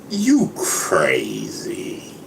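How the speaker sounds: very slow, stretched-out you crazy; drawn-out retort. Retold or imitated. Retelling a friend's reply, slow drawn-out scoff. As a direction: drag out every sound in disbelief. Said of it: "He says".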